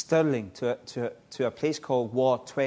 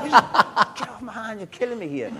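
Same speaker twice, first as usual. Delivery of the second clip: mock pained yell; 10 semitones higher, 7 dB louder than usual. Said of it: "I'm like".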